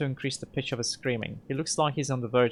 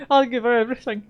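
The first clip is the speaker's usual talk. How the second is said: much higher, louder, desperate cry; breaking loud plea; 10 semitones higher, 8 dB louder, faster than usual. Acted. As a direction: cry it out as you cave in.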